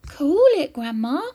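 a hint of an eager grandson; lightly voiced boy. A narrator's reading. George calling to his burning grandma, lightly acted urgency.

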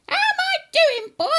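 put-on very high, loud old-woman shriek; high-pitched grandma yell; shouting grandma. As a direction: holler it as a triumphant granny. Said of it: "she shouted".

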